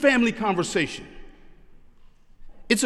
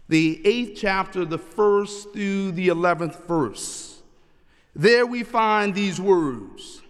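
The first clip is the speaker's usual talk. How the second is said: slow, measured liturgical introduction; solemn scripture announcement; slower than usual. Acted. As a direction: announce the reading phrase by phrase.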